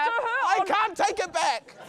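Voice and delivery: high, slow, drawn-out wail. Real, not acted. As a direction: stretch it into a high despairing wail. Apologising after cursing everyone's children.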